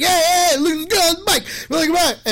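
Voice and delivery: high, loud calling out, hey hey hey; a kid hollering at a girl on a bike; loud heckling. Retold or imitated. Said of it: "I'm going on and being so loud".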